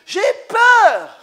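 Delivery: sudden high loud cry of fear; scared shout. Pretend. Preacher acting his stage fright with a loud shout. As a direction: burst out in mock panic. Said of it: "Je me lève".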